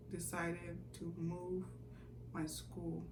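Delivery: barely voiced, the shock dawning; stunned realization. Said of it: "things started clicking".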